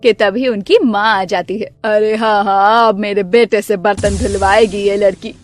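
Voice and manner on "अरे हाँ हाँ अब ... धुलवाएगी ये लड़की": low, sneering mother-in-law voice; lower, mocking saas; taunting mother-in-law. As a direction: jab at the daughter-in-law, low and sour.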